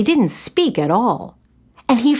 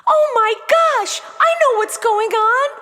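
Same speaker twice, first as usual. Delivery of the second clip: put-on shocked young girl realizing the truth; shocked realization; 12 semitones higher than usual. Girl realizes she's been tricked.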